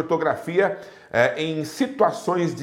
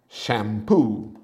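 "shampoo" in an English accent, lower and drawn out; English pronunciation; 6 semitones lower than usual. Shown as a demonstration. Teacher citing the source word, English pronunciation. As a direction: switch to English for "shampoo", stretching the final oo. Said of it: "que vem também do inglês".

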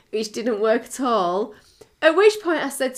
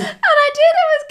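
loud, high laughing admission; real loud laughter; 7 dB louder, much faster than usual. Real, not acted. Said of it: "Keep digging".

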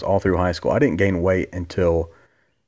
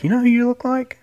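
put-on girl's voice. Girl asks him, voiced by teller.

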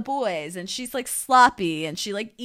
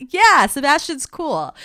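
higher, louder, drawn-out guy impression; impressed guys; 8 semitones higher, 7 dB louder, slower than usual. Guys approving of Sebastian after the dumping, slow mock admiration. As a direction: mimic the guys slowly falling in line. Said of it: "all the guys are like".

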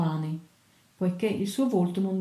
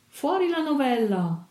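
calling out; 7 semitones higher than usual. A narrator's reading. Sailors demand the story from the mastro, calling out high. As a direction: cry out like an impatient audience. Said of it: "gridarono tutti".

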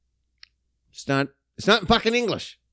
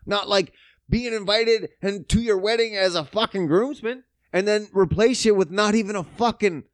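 ordinary talk, nothing marked

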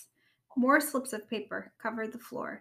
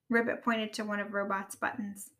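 ordinary delivery, nothing marked

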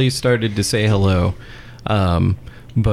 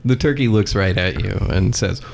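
ordinary talk, nothing marked

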